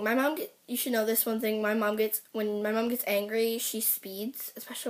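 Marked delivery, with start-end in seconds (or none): 3.6-4.9 whispered aside. Real, not acted. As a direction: drop to a whisper for this bit. Aside about mom's anger.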